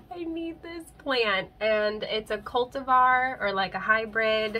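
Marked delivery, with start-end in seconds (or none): 0.1-1.5 high, drawn-out need; slow, raised-pitch imitation of wanting it badly; acted longing plea. Buyer recalling the second time around, high drawn-out plea. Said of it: "I was like".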